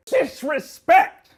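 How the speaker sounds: high, slow furious bellow; angry shout. Acted. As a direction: bellow the word in outrage. Boss bellows the word.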